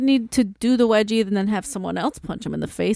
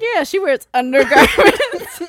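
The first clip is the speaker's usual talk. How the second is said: laughing quote; 5 semitones higher, 5 dB louder than usual. Retold or imitated. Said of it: "I was like".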